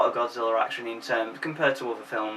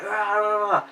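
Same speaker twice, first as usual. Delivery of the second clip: pumped-up Godzilla growling, higher; mock monster roar; 7 semitones higher, slower than usual. Godzilla pumped up by lightning, mock roar. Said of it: "He's just like".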